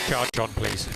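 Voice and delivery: ordinary delivery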